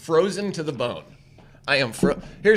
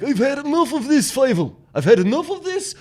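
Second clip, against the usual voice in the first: high, exasperated cartoon dad; Fievel's dad; 9 semitones higher, 6 dB louder than usual. Retelling the movie, high voice for the fed-up dad. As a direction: huff it out like a fed-up father. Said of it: "And the dad's like".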